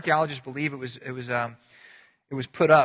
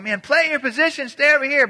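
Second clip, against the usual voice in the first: high, loud coach's call; imitated coach shouting orders; yelling, not meanly; 10 semitones higher, 7 dB louder than usual. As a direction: yell instructions across a field at little kids. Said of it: "I'm yelling at 'em".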